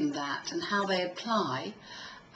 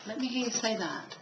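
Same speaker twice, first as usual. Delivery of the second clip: usual speaking voice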